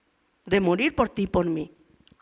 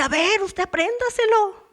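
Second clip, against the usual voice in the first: rude yell; 10 semitones higher than usual. Retold or imitated. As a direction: snap back like a defiant teenager. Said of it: "y le grité". (grito insolente de adolescente)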